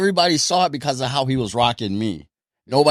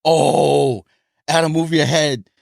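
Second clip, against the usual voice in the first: drawn-out, higher mock ringside call; imitated ringside heckling; 4 semitones higher, 3 dB louder, slower than usual. Onlookers advising the boxer, mock calling out. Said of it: "hearing noise from outside the ring".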